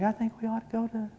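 tiny, high, barely audible voice; quiet and meek. Retold or imitated. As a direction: imitate a meek wife, trailing away. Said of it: "she's kinda quiet and meek".

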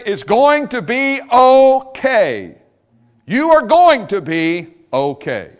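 high, loud, slow comfort; loud slow reassurance. Acted. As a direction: promise it to the whole room. Preacher reassuring loudly.

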